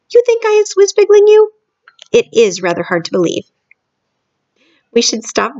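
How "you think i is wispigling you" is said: high, loud, indignant BFG; indignant giant. Acted. BFG challenges doubting Sophie, high and loud. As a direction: throw the question back, offended.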